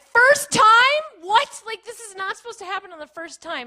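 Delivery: high, loud shocked outburst; retold high-pitched freak-out. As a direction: shriek it in disbelief, then keep protesting high. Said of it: "I started freaking out".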